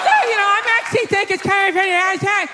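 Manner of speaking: lightly voiced meddling wife; mock chiming-in wife. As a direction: barge in like a know-it-all wife. Plumber's wife butting in.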